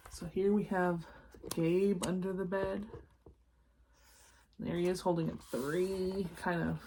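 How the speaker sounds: ordinary delivery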